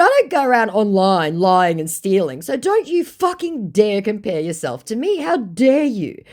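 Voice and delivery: how dare you, slowing at the end; retold furious reply.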